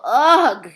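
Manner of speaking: loud disgusted sputter; girl gagging on vile food; sputtering ugh. Acted. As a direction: spit out the taste. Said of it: "she sputtered".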